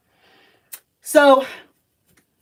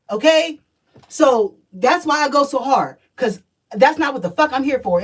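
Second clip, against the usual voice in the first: ordinary talk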